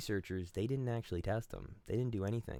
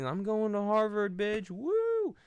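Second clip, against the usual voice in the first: much higher and louder, cocky cheer ending in a whoop; gloating kid; 11 semitones higher, 6 dB louder, slower than usual. Acted. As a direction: brag like a teen who just got in. Mocking a gloating gifted kid.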